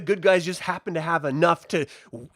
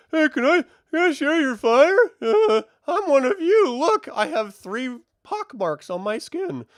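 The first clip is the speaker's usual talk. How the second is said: mock Theo; 8 semitones higher than usual. Mocking Theo walking into camp.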